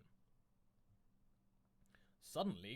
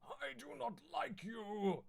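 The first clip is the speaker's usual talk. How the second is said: high, slow, loud hammed-up rage; slow theatrical fury; mock angry; 11 semitones higher, 4 dB louder, slower than usual. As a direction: spit it out like a sulking brute. Broly enraged at Goku.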